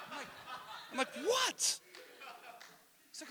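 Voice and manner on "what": very high, soft incredulous squeak; startled disbelief. Retold or imitated. Father startled by son's outburst, acted high disbelief. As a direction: re-enact a stunned high-pitched reaction.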